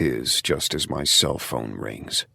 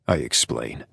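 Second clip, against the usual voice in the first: usual speaking voice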